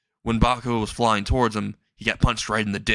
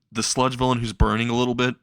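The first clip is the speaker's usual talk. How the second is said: ordinary delivery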